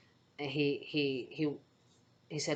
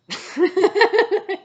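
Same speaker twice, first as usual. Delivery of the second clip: a sudden, much higher real laugh bursting out; loud burst of laughter; 16 dB louder than usual. Real, not acted. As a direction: laugh out loud, caught off guard by the memory. Laughing at the hugging story.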